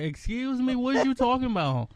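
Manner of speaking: high-pitched, indignant questioning; acted-out collective disbelief; incredulous class. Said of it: "the whole class was like".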